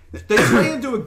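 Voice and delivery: overdone Scottish groundskeeper voice; groundskeeper Willie Scottish. Retold or imitated. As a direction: ham up a cartoon Scottish accent. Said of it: "a groundskeeper Willie".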